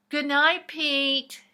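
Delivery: called out high and loud; loud sing-song goodnight. Acted. Friends calling a goodnight to Pete loudly. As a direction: sing it out across the room.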